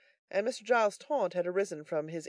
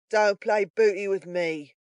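loud, slow, higher-pitched threat; threatening cry; 4 semitones higher, 8 dB louder, slower than usual. A narrator's reading. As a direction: warn your partner off, loudly and slowly. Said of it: "cried Sikes".

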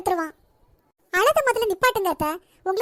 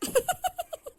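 high laugh; 10 semitones higher, 5 dB quieter than usual. Real, not acted. Mother laughs at daughter.

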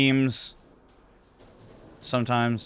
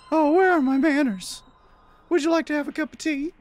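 very high hostess falsetto; polite woman. Acted. Woman offering her visitor tea, polite high falsetto. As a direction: play a courteous lady, pitched way up.

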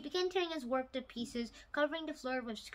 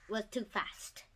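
plain reading voice

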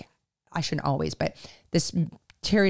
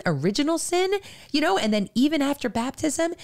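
normal talking voice

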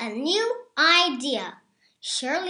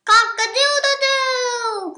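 much higher crowing call; rooster crow; 11 semitones higher, 8 dB louder than usual. A narrator's reading. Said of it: "crowed Rooster".

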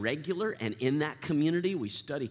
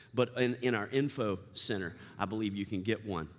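normal talking voice

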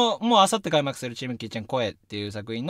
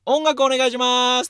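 high, raised call with a long ending; 10 semitones higher, 5 dB louder than usual. Retold or imitated. Actor turns toward sound crew for music, calling out. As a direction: re-enact calling across to the sound booth. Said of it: "音響さんの方角に向かって".

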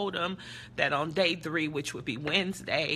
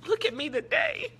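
tearful encouragement. Real, not acted. Tearfully retelling her encouragement to crying girl.

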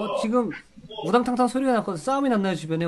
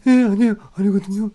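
exaggerated, louder [name] impression; 3 semitones higher, 6 dB louder than usual. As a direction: ham up [name]'s voice. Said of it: "예성이 성대모사 하면".

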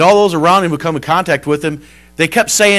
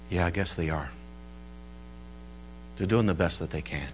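quiet, low, resigned retelling; low, subdued reply; downcast; 11 semitones lower, 13 dB quieter than usual. Retold or imitated. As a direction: answer softly with head hung. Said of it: "she hung her head".

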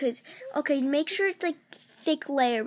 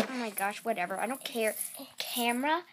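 ordinary delivery

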